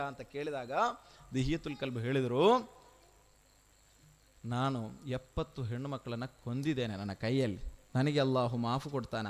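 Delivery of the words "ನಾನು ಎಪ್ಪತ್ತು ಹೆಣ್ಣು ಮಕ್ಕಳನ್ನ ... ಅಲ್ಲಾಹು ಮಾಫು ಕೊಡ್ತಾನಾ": low, soft voice of a penitent; hushed confession. Retold or imitated. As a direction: confess quietly and ask for forgiveness. Weeping convert confesses a sin. (ತಗ್ಗಿದ ಧ್ವನಿಯ ತಪ್ಪೊಪ್ಪಿಗೆ)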